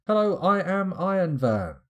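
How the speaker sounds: high, loud mock introduction; big high-pitched silly proclamation; hammed-up announcement. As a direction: belt it out high and playfully grand. Breaks into Iron Man parody.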